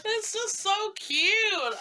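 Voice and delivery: high, slow cooing; squealy delight; gushing. Real, not acted. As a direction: swoon over it.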